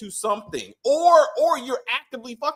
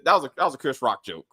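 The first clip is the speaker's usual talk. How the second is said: ordinary talk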